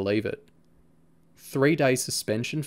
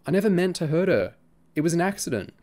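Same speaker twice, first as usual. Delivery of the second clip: pleading; 8 semitones higher, 3 dB louder, faster than usual. A narrator's reading. Boy pleading with friend.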